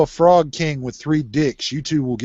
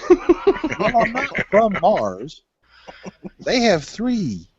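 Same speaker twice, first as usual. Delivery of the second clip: high slow mock voice; 7 semitones higher, much slower than usual.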